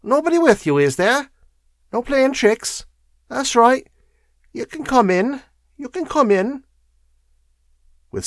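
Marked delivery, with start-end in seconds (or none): 0.0-6.6 high-pitched wheezy crone. A narrator's reading. Bent woman letting the lawyer into her room, wheezy. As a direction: wheeze it high, wary of tricks. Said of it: "she said in a wheezy voice".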